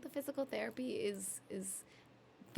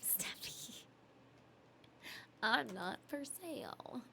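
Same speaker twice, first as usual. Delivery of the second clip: slow, breathy whisper; 8 dB quieter, slower than usual. Real, not acted. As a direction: hush it like a secret. Whispering a reply to chat.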